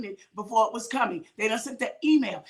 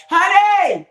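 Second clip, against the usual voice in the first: loud, high holler across the house; calling husband; 6 semitones higher, 13 dB louder than usual. Acted. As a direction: yell for your husband to come. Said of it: "You see how quick I call my husband?".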